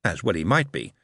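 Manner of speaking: ordinary delivery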